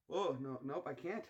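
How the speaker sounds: usual speaking voice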